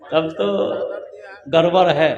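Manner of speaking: low, soft deadpan; dropped punchline. Real, not acted. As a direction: drop the voice for the joke. Punchline after drunk driving.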